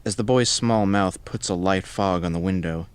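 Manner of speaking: usual speaking voice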